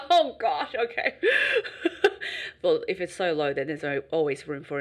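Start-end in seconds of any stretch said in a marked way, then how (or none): 1.2-2.6 high laugh. Real, not acted.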